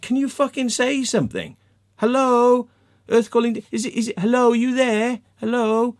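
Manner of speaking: high, loud, impatient calling out; exasperated prodding. Acted. Thinking at silent Dean in the room, high exasperated prodding. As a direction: call to someone who won't respond. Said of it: "but I'm just thinking".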